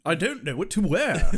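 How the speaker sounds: fretting Englishman, put-on British accent; mock English accent. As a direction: imitate a flustered Brit. Voices insecure Giles, English accent.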